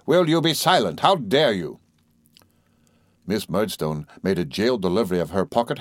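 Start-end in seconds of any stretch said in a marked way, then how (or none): 0.1-1.8 higher, indignant rebuke; thundering. A narrator's reading. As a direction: thunder mildly at a defiant sister. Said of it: "thundered Mister Murdstone".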